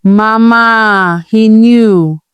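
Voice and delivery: long, drawn-out vowels, lightly wailed; acted wail. A narrator's reading. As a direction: wail it to Mama without sobbing. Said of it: "Ovi wailed".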